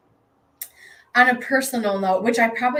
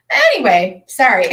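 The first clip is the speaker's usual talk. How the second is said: louder, bursting into a sheepish laugh; embarrassed laugh; 4 semitones higher, 8 dB louder than usual. Real, not acted. To her viewers after tearing up, sheepish laughing. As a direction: laugh off the tears, a bit loud.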